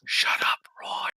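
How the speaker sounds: whispered telling-off from hiding; furtive whisper; very quiet. Acted. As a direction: whisper it so only he hears. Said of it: "going very, very quietly".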